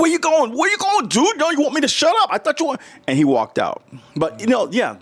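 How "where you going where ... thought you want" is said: much higher taunting call after him; challenging. Retold or imitated. Shouting after the man who walked away, much higher and challenging. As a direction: call him back, daring him to leave.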